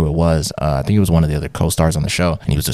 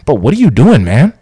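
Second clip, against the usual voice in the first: higher, louder mock alarm; alarmed question; 8 semitones higher, 5 dB louder than usual. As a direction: call out a stranger raiding your fridge.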